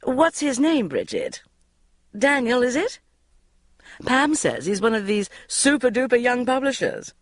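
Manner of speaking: high, nosy auntie voice; prying Una. A narrator's reading. Una prying about Bridget's boyfriend, high and nosy. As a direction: needle her with fake sweetness.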